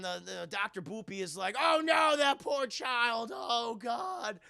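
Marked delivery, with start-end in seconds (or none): 1.6-4.4 loud, slowed mock anguish; hammed-up horror. Doctor Boopy watching the child injected, hammed-up horror. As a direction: wail it in hammy dismay.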